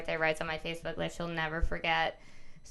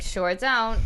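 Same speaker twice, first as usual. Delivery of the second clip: put-on loud, flat-toned little kid answer; kid's deadpan reply; 2 semitones higher, 7 dB louder than usual. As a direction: answer like a matter-of-fact kid. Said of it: "and he's like".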